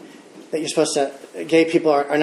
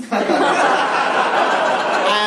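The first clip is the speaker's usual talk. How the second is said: light breathy laugh after the punchline; 5 semitones higher than usual. Real, not acted. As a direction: chuckle at the memory. Laughing at own story.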